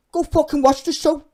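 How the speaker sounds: shouted high and loud at the commenters; real furious outburst; angry shout. Real, not acted. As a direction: yell it, fed up. Ranting back at commenters.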